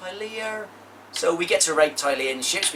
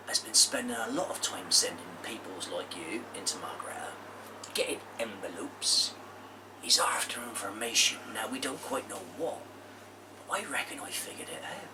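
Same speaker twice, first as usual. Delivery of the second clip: stage whisper; 4 semitones lower, 10 dB quieter than usual. Acted. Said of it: "whisper loudly so they can hear".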